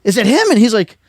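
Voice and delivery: urgent, thrilled calling out; excitedly retelling the hunt, pitch way up; frantic excitement. Retold or imitated. Frantic search for the shot buck.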